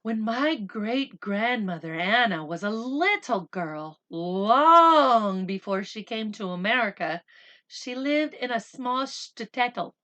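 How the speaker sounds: slow, even storybook reading; unhurried read-aloud voice; story narration. A narrator's reading. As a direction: read it gently to the children.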